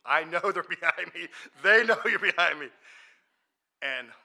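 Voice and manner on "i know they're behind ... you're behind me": high, very loud retort; loud defiance. Retold or imitated. Answering a friend who shushed him, loud defiance. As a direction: refuse to be hushed, building louder.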